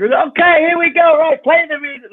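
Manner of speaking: high, loud rushed cover-up; frantic showbiz shout. Retold or imitated. Host cues music after heckle, high frantic shout. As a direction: barrel on loudly to save the moment.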